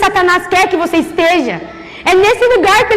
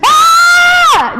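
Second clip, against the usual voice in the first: acted scream; 10 semitones higher than usual. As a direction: scream out as if something grabbed your leg. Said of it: "Dei um grito". (grito agudo de dor, encenado)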